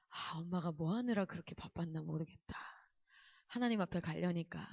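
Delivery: rueful regret. Retold or imitated. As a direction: quietly admit a wasted life. Mother's rueful thought facing death.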